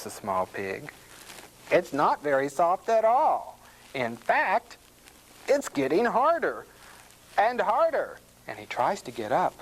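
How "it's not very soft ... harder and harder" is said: higher pig voice, climbing further; mounting alarm. A narrator's reading. Small pig stuck in cement, growing alarmed. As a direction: raise the pitch as the worry builds.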